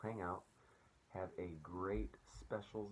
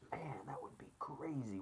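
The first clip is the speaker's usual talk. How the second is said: awed whisper; 6 semitones higher than usual. Real, not acted. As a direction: whisper it amazed. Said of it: "awed whisper".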